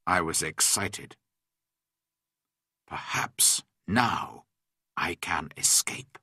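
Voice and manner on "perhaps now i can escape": low, slow, near-whispered private thought; said to oneself. A narrator's reading. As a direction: murmur it softly to yourself. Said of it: "I said to myself".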